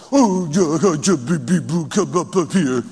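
gibberish cooing to an infant; mock baby talk. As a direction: coo silly babble as if to a baby. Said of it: "don't talk baby talk".

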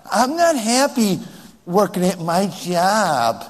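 slow, drawn-out mock pouting; mock unhappy husband. Man moaning about his job, slow mock pout. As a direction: draw out the complaint like a sulker. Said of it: "Men don't talk like that".

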